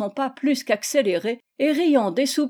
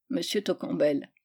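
usual speaking voice

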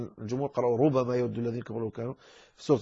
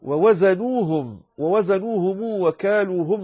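slow recitation; 4 semitones higher, 4 dB louder, slower than usual. Shown as a demonstration. Reciting the verse slowly.

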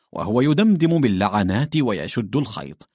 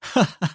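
burst of laughter; 7 semitones higher than usual. A narrator's reading. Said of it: "انفجر الإقطاعيان ضاحكين".

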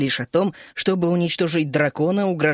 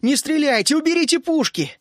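high, urgent shouted command; yelling; 9 semitones higher than usual. A narrator's reading. Narrator begging gunners to spare his friends, yelling. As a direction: yell out high to halt the guns. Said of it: "заорал я".

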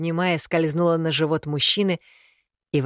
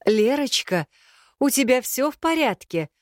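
higher, worried mother's voice; concerned mother calling; 8 semitones higher than usual. A narrator's reading. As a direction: call out to daughter, concerned. Mother calls through wall.

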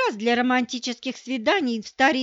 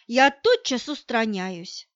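plain reading voice

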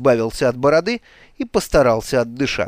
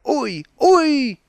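high drawn-out yelp; crying out; 11 semitones higher than usual. A narrator's reading. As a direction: yelp as if struck. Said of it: "вскрикнул он низким голосом". (протяжно вскрикнуть)

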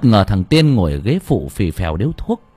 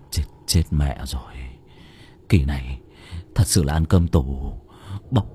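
frightened muttering; 6 semitones lower than usual. A narrator's reading. Driver muttering in fear about going to prison, low frightened mumble. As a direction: mumble it in dread. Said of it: "hắn cũng lẩm bẩm sợ hãi".

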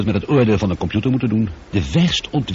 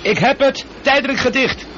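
urgent relief; 14 semitones higher than usual. Acted. Christopher sealing the hull leak, calling out urgently excited. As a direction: shout it over the alarm, relieved.

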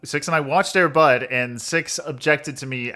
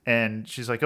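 normal talking voice

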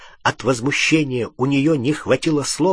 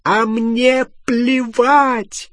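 high, loud, drawn-out yell; furious high-pitched howl; screaming; 11 semitones higher, 5 dB louder, much slower than usual. A narrator's reading. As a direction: scream it out, stretching each word. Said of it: "завопила Гермиона".

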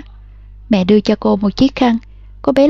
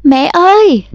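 high, louder scream for mother; crying out; 5 semitones higher, 4 dB louder, slower than usual. A narrator's reading. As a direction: scream for mom in panic. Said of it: "Giờ thì cô bé gào lên". (gào lên gọi mẹ)

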